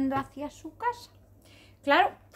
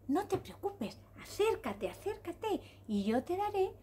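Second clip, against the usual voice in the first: soft, higher-pitched gentle rainbow; soothing reassurance; 6 semitones higher, 7 dB quieter than usual. Acted. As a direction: coax tenderly, hushed and high. Rainbow reassures the crying zebra.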